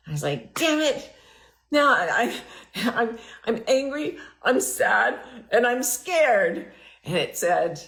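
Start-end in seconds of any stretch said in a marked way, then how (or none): 0.5-6.7 mildly worked-up protest, pitched up; retold exasperated outburst. Speaker replays complaining to the voice in her head, mock exasperation. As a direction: replay a frustrated outburst, higher than usual.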